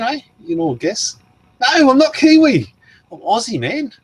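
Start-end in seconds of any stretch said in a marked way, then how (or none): 1.6-3.9 high, loud protest, dropping in volume after; playfully outraged denial, pitched way up; mock indignation. Mock protest at being called Kiwi.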